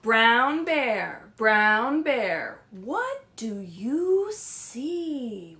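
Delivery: loud, slow sing-song reading of the refrain; read-aloud refrain. Acted. Librarian reading to children at home, loud slow read-aloud. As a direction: draw out each word for little listeners.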